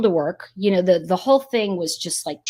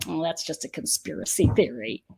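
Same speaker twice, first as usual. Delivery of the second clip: dismissive scoff; 5 semitones lower, 8 dB quieter than usual. Retold or imitated. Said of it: "the other side's going".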